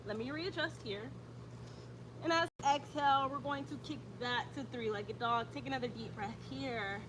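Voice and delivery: calm, even yoga class cues; steady instructor voice pacing the breath; yoga instructor cueing. Acted. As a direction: guide the class through each breath. Leads a yoga flow.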